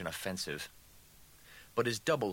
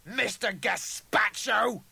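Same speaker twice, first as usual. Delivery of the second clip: high, loud, drawn-out taunt; jeering sneer; 13 semitones higher, 7 dB louder, slower than usual. A narrator's reading. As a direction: hurl the name as a slow jeer. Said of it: "the duplicate sneered".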